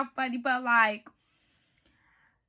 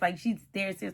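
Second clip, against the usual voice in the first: ordinary talk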